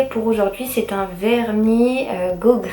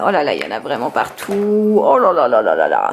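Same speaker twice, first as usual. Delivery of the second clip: low, sinking oh-là-là; mock dismay; 8 semitones lower than usual. Real, not acted. Mother seeing crumbs everywhere, mock dismay. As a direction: let the voice drop in playful despair.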